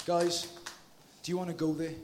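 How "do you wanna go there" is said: normal talking voice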